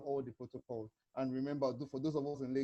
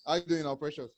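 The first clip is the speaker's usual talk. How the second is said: louder, clear call; speaking up; 7 dB louder than usual. Real, not acted. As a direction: project so they can hear. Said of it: "Can you hear me now".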